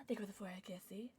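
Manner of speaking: fast, soft whisper; hushed, hurried thank-you. Pretend. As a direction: whisper it quickly as a little ritual. Repeated thanks, here whispered.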